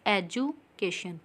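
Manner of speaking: "Education" broken as एजु, pause, cation; split into syllables. Shown as a demonstration. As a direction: say "education" in two separate beats. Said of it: "टू times में बोला गया है". ("Education" दो टुकड़ों में, बीच में रुककर)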